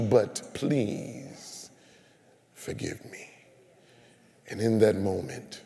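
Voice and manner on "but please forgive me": whispered plea. Retold or imitated. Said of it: "caller pleading".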